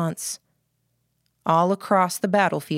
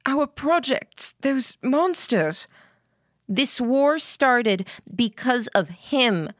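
put-on high, slow, emphatic girl's voice; accusing Lillian; 7 semitones higher, slower than usual. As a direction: lay the blame slowly in a raised pitch.

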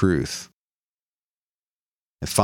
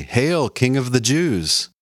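slow, raised mocking hail; mocking salute; 7 semitones higher, slower than usual. Soldiers mocking Jesus with a slow, high hail. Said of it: "salute him mockingly".

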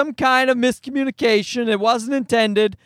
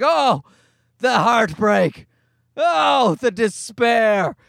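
slow, hammed-up tragic moan; moaning like a Shakespearean actor; much slower than usual. Dad imitating Cookie Monster's cookie grief, hammy Shakespearean moan. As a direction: wail it like a ham actor on stage. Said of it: "moaning like a Shakespearean actor".

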